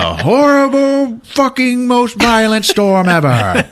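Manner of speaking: loud, high, stretched-out words; hammed-up shouting. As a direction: bellow it slowly like a raging storm. Dramatizes the violent storm.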